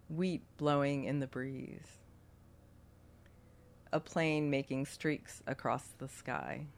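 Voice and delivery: guided meditation. Acted. Leads a meditation.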